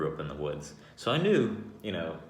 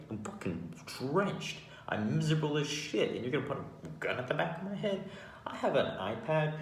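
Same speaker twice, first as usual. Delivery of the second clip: retold indignant protest; 6 semitones higher than usual. Skinny, drenched salesman protesting the gun, indignant. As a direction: protest your harmlessness, voice riding up. Protests being seen as threat.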